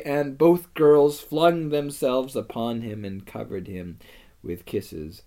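usual speaking voice

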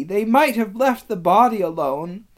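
high, loud, tearful complaint; distraught protest. A narrator's reading. Lucy grieving the missing body, distraught. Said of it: "sobbed Lucy".

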